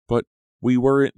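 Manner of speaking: protesting. A narrator's reading. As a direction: protest the accusation with heat. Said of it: "Della protested".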